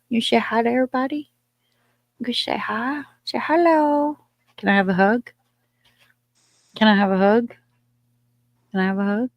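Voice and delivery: high, cooing pet voice. Real, not acted. Baby-talking to her dog.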